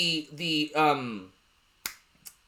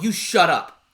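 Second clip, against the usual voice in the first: a hint of a stern parent snapping; lightly voiced parent shutting the kid down; mock stern parent; 3 semitones lower than usual. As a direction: brush the kid off like a curt parent. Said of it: "this is how my parents were".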